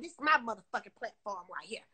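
ordinary delivery

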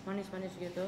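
talking with mouth full; speaking between bites; chewing food. Real, not acted. Seen 1: mouth closed, moving, just ate noodles; chicken itself unclear.

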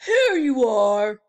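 loud, high, cheery bellhop welcome; chirpy old-fashioned bellhop. Acted. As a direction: call out the welcome like a grand hotel porter. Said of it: "said the bellhop".

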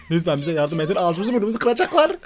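high, laughing mock plea for help; hammed-up cry for help. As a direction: ham up a desperate call. Mock begging into radio.

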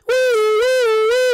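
very high, loud siren wail; imitated siren. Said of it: "make the sound effects".

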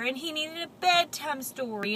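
ordinary delivery, nothing marked

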